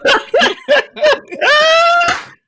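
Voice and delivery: big high burst of laughter; loud laugh. Real, not acted. Laughing hard at the gentlemen on her podcast, loud and high. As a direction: cackle out loud at the thought.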